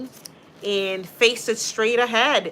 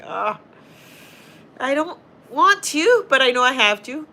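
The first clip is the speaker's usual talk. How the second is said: higher and louder, a hammed-up reluctant groan; mock whine; 6 semitones higher, 6 dB louder than usual. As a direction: play it up like you really don't want to work. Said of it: "So I'm like".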